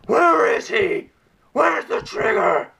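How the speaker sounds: high, loud, slow shouted Batman impression; imitating a shouting Batman interrogation; Bale-style Batman yell. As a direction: bark it like the old movie Batman. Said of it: "Not his growly voice like".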